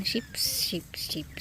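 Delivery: normal talking voice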